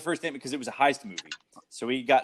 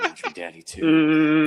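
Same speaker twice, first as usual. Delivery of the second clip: slow, pitched-up mock of the villain's line; mock movie villain; 5 semitones higher, 3 dB louder, slower than usual. Villain answering a little girl in her room, slow mocking imitation. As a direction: draw it out, imitating the odd delivery. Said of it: "It's the way he said it".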